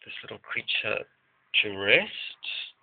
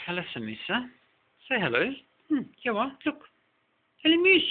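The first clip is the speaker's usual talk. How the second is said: much higher, sing-song voice for the cat; pet talk; 13 semitones higher, 3 dB louder, faster than usual. Real, not acted. Talking to her cat.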